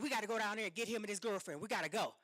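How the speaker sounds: rushed urging. Retold or imitated. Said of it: "He's like".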